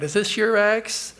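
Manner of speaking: the Lady of the Lake offering the silver axe, lightly voiced. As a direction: ask it sweetly, up high.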